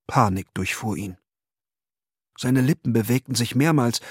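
normal talking voice

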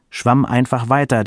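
plain reading voice

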